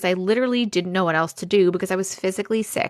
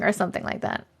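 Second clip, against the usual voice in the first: ordinary talk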